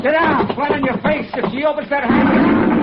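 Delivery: high, sharp order to take cover; urgent command. Acted. Johnny ordering Dan to take cover, urgent shout. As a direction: get them down now.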